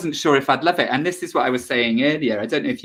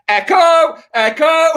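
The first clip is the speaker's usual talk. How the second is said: high, loud newspaper seller's cry; street seller shout; 7 dB louder, slower than usual. Retold or imitated. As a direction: call out the paper's name like a street vendor. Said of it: "a man in the street that used to shout".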